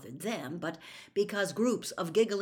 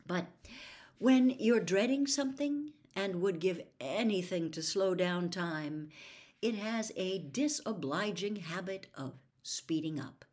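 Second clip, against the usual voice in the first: ordinary delivery, nothing marked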